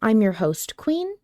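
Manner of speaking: normal talking voice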